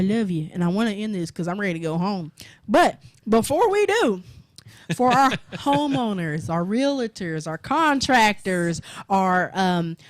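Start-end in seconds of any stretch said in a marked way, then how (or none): 2.7-10.0 slow, emphatic list; ad read. Acted. Host plugging the other show to homeowners in an emphatic ad read. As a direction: sell the other show to listeners.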